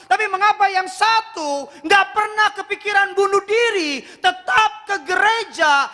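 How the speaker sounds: high, driving preacher's voice; preaching at full force. Acted. Pastor pressing the congregation, full-force preaching.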